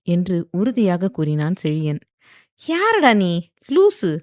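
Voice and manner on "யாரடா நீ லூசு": sharp, much higher and louder; glaring retort. A narrator's reading. Wife glaring at her husband, high and sharp. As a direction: snap it at him like he's crazy. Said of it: "என்று அவனை முறைத்தாள்".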